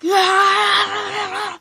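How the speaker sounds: high, loud, drawn-out scream; long wavering yell at full volume; imitated scream. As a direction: scream out as if in agony. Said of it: "Eddie starts screaming like".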